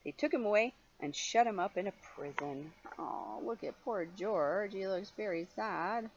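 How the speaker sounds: usual speaking voice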